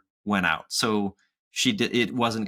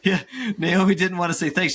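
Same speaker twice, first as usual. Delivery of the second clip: mock kid voice; 9 semitones higher, 4 dB louder than usual. Mocking the kids' group.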